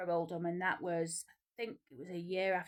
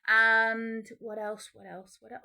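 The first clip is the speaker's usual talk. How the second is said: drawn-out musing; 2 semitones higher, 7 dB louder, slower than usual. Real, not acted. Drawn out thinking aloud.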